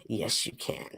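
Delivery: soft whispered self-answer. Real, not acted.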